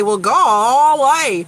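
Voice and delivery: drawn-out, loud country bumpkin; Gomer Pyle. Retold or imitated. Woman mocking how a dude she talked to sounded, country bumpkin imitation. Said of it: "that's how he sounded".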